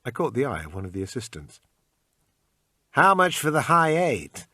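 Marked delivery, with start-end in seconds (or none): 2.9-4.5 a deliberately bad American accent, high, loud and slow. Asking the pawn shop assistant the price in a bad American accent. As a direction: put on a clumsy American accent to haggle. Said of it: "in my usual bad American accent".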